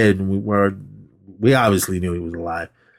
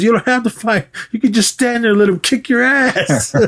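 mocking taunt; 13 semitones higher than usual. Villain taunting retold.

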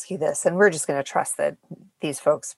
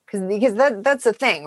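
ordinary delivery

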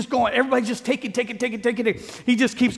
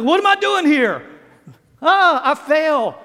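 mock surprise; 6 semitones higher, 4 dB louder than usual. Mock surprised excuse, louder.

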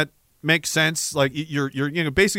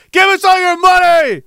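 rising from firm to a high, loud yell; hammed-up demand that erupts into a shout; mock greedy shout; 11 semitones higher, 8 dB louder than usual. Pharma hucksters demanding money, mock shouting.

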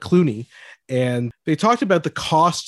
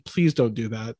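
ordinary talk

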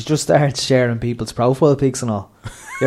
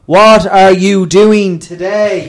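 loud, slow, high-pitched mum; booming over-enunciated small talk; mum addressing foreign students; 8 semitones higher, 10 dB louder, much slower than usual. Retold or imitated. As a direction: spell it out slowly and loudly for foreigners. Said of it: "the way she talks to the Spanish students".

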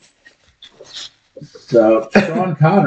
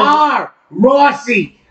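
shouting; 11 semitones higher, much slower than usual. Retold or imitated. Shouted name.

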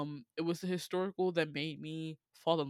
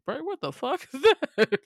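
incredulous; 8 semitones higher, 9 dB louder than usual. Retold or imitated. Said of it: "I said".

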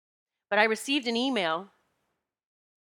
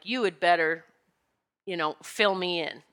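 usual speaking voice

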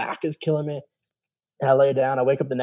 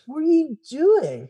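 baffled; slower than usual. Retold or imitated. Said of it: "I was like".

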